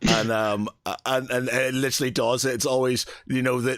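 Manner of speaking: ordinary delivery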